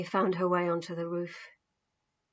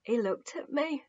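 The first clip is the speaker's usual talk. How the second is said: tearful; 4 semitones higher, 4 dB quieter than usual. A narrator's reading. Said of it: "tears rolled down his cheeks".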